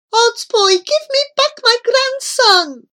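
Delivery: high, loud, anguished demand; pleading wail at full voice; broken-hearted cry. A narrator's reading. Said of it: "With a broken-hearted voice, Grandfather Petamiengi cried out".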